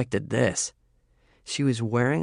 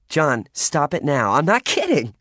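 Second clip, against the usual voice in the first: laughing protest; 5 semitones higher, 4 dB louder than usual. Acted.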